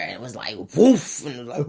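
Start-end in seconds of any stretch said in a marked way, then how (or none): 0.8-1.2 loud, higher whoosh of flames; imitated whoosh. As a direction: burst out like fire catching. Imitates fire bursting into flame.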